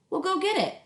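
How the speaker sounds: a busy mom calling up; mom's voice. Acted.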